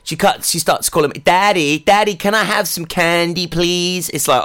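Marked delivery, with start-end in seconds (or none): 1.2-4.1 put-on high, childish American twang; imitating American child accent. Dad imitating his little daughter's American accent. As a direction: mimic a four-year-old's American accent. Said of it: "she's got this American twang".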